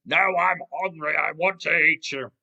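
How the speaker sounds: loud crocodile bellow; hungry crocodile. Acted. Crocodile insisting to the pleading tiger, loud bellow. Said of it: "but Craig's going".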